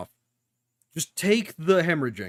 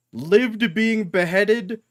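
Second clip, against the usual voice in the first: slow, drawn-out, louder; stretched, louder, amazed; awed emphasis; 6 semitones higher, 4 dB louder, much slower than usual. Real, not acted. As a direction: savour each word in awe. Awed reaction to anime scene.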